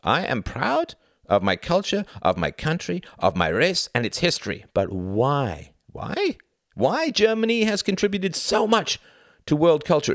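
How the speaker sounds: high, emphatic, insistent declaration; proud zealot. Acted. Klaus declares pride in country to Tom, fervent. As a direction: swell with pride, then press the question.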